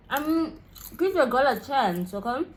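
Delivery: high, loud playful begging; pleading. Pretend. Begging the exam for mercy.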